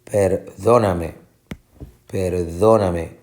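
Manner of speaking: slow perdóname with DÓ stressed; stress on second syllable. Shown as a demonstration. Teacher checking the stressed syllable, slow second-syllable stress on perdóname. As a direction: lean slowly on per-DÓ-na-me. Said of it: "Va a ser perdóname".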